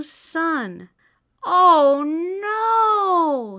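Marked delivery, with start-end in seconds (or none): 1.4-3.6 dismayed high sigh; a mouse's weary oh no. A narrator's reading. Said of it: "sighed the mouse".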